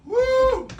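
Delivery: high hoot of pain; high burning woo. Real, not acted. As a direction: yelp from the spice heat. Burning from hot sauce.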